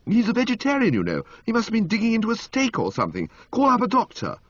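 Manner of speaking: a rattled gentleman raising his pitch; flustered young gent. A narrator's reading.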